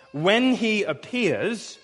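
very slow, weighted phrasing; slow emphatic reading. Shown as a demonstration. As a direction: lean into each word slowly. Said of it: "look at that word".

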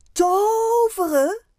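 high, drawn-out cry of disbelief; loud astonished question. A narrator's reading. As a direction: exclaim it slowly and loudly, rising at the end. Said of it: "zegt Fien hard". (hard en verbaasd uitgeroepen)

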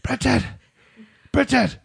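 high-pitched mock yell up the stairs; put-on holler, each call separate; imitated yelling. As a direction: imitate a drunk-night caller summoning someone downstairs. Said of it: "yelling up my stairs".

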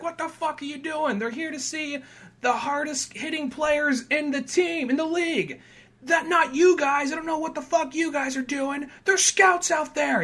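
a publicist chewing out the players, high and exasperated; irate publicist. Retold or imitated. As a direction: berate the team like a furious publicist.

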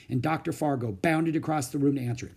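plain reading voice

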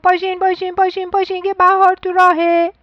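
high, loud, excited calling; noisy wake-up call. A narrator's reading. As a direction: rouse everyone with a loud, high shout. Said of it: "با سر و صدای زیاد گفت". (با سر و صدای زیاد)

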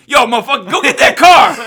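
loud, high mock bellow; imitating brash mom. Co-host acts out mom ordering her scared son, mock bellow. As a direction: bellow it like a hammed-up bossy mother. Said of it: "and she's like".

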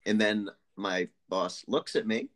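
ordinary talk

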